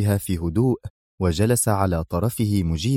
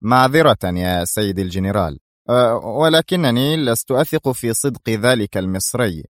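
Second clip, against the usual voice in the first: ordinary delivery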